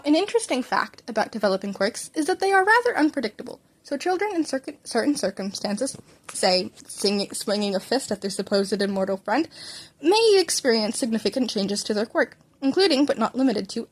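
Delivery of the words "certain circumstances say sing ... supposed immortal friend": plain reading voice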